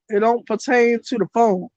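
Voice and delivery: ordinary talk, nothing marked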